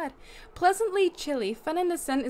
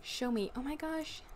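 usual speaking voice

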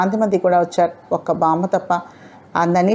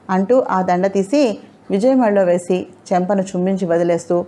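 usual speaking voice

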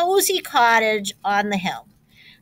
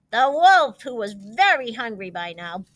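ordinary delivery

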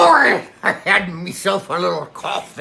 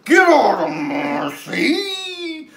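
Kraken voice; 6 semitones higher, much slower than usual. Acted. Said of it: "then the Kraken said".

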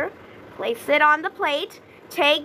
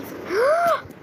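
sharp shocked gasp; 12 semitones higher than usual. Real, not acted. Gamer seeing a rat steal lettuce, sharp gasp. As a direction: gasp hard, caught off guard.

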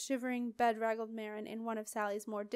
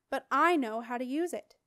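louder protest, pushing back; 2 semitones higher, 8 dB louder than usual. Acted. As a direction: insist you know how. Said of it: "protested Simon".

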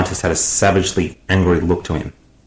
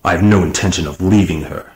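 coldest angriest voice. A narrator's reading. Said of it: "using the coldest, angriest voice".

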